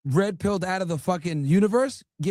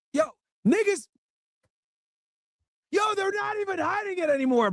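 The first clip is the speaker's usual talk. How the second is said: excited shout. Real, not acted. Streamer reacting to a clip.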